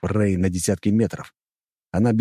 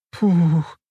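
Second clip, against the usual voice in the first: high puffed-out sigh; exasperated exhale; exhaled huff; 10 semitones higher, 3 dB louder than usual. A narrator's reading. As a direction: let the word out on a breath. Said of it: "выдохнула она".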